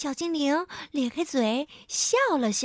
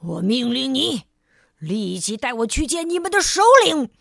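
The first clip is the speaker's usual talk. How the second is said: louder, bossy demand; angry command; 5 dB louder than usual. Acted. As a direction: order him around angrily. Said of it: "傲慢先生生气地说". (生气地命令)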